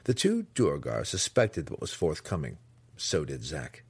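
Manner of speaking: plain reading voice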